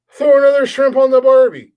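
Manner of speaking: loud, high mock Aussie line with no accent at all; no accent attempt. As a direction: belt the catchphrase in his own voice. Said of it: "I didn't even try to do an accent".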